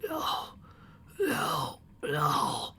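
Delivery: weak, husky, crying no. Acted. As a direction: plead weakly through tears. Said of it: "in a weak but husky voice".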